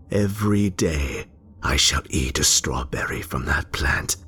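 plain reading voice

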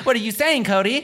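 high, put-on girlfriend voice; mock girlfriend voice. Teasing his friend, voicing the girlfriend.

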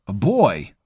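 startled shout; exclaimed. A narrator's reading. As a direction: blurt it out in shock. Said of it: "Javin exclaimed".

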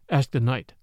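usual speaking voice

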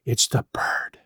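low, hushed whisper. A narrator's reading. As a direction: whisper it as a dreadful discovery. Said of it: "she whispered".

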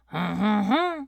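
stock French "huh, huh, huh"; mock French chuckle. Shown as a demonstration. Coaching a friend on a French accent, French huh-huh. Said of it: "you just have to go".

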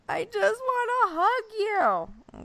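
high, slow mock sobbing whine; crying child. Crying son asking mom for a hug, high mock wail. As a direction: imitate a little boy wailing for comfort. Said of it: "he started crying harder".

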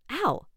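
sharp high yelp of pain. Acted. Sudden sharp pain in neck.

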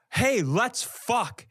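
ordinary talk, nothing marked

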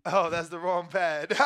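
normal talking voice

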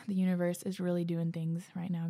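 usual speaking voice

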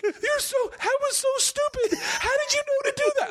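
high, far-off laughing voice, still cracking up; laughing brother. Retold or imitated. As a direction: quote him while laughing hard. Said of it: "your brother laughed for a solid five minutes".

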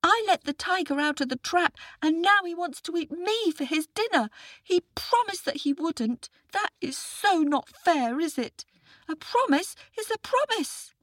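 put-on high, earnest little boy's voice; a small boy calling for help; young boy. Boy pleads with jackal.

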